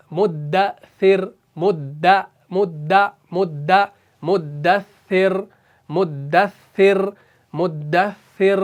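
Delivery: مدثر drawn out, the doubled dal pressed hard; slow articulation drill. Shown as a demonstration. Teacher repeating مدثر for learners, slow drill. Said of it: "دال کا مخرج حروف نطعیہ".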